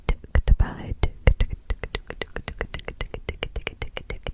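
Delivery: whispered ticks dying off; clock ticking, fading away. Pretend. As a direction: let the ticks fade into silence.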